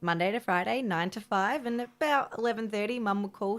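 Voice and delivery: ordinary delivery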